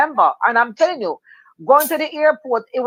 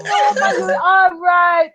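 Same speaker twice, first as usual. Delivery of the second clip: stewardess voice; 7 semitones higher, slower than usual. Retold or imitated. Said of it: "she said".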